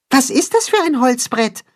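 tiny high-pitched mole voice; Max, inquiring. A narrator's reading. Mole Max asks about the wooden board, curious. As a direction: ask in a squeaky little character voice. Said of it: "fragt Max".

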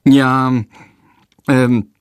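nervous, halting stammer; stammering boy, unsure. A narrator's reading. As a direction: fumble for words, caught out. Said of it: "stottert Jendrik".

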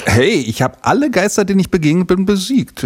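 high-pitched mock-indignant protest; playful boast. Real, not acted. Host defending ghost-beating to kids, playful boast. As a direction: proudly defend your record, tongue in cheek.

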